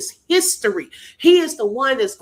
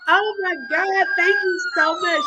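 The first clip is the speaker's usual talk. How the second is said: overwhelmed thanks; 9 semitones higher than usual. Real, not acted.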